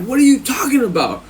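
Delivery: high-pitched baffled imitation; tripping cellmate. Cellmate tripping at the warning, high baffled voice. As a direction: object high, in disbelief. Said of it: "tripping, like".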